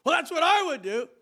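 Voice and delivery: mock casual coworker; coworker's reply. Coworker defending his cursing, high put-on voice.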